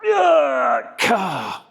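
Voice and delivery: loud high effort yell; straining shout. Real, not acted. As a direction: force out the last rep. Grunting out final rep.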